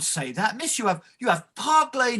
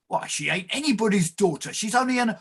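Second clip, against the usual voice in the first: ordinary talk, nothing marked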